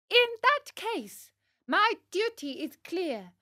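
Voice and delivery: put-on high, brave puppet boy's speech; proud, high-pitched heroic declaration; piercing voice. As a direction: cry out your noble sacrifice in a high voice. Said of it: "said in a piercing voice".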